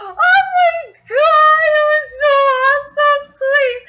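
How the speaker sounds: swooning fangirl wail; gushing. Real, not acted. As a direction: squeal with overwhelmed delight. Gushing over love declaration.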